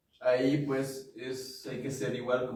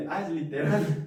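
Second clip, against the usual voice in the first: laughing; 4 semitones higher, 8 dB louder than usual. Shown as a demonstration. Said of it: "Si oliera raro".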